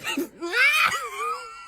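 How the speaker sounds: very high shriek of laughter. Real, not acted. Choking laugh mid-sentence.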